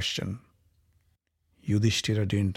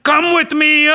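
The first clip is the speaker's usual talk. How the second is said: higher, louder, slow gloating with laughter; mocking villain; 6 semitones higher, 6 dB louder, slower than usual. Dussasana gloats over Draupadi, laughing cruelly. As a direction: gloat over your captive.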